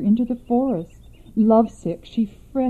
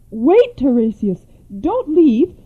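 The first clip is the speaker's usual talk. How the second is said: raised, louder call after someone leaving; urgent plea; 4 semitones higher, 4 dB louder than usual. Acted. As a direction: call him back before he goes. Mother calls after departing seer.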